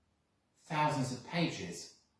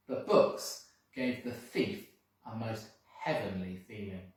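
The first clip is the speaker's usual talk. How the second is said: ordinary delivery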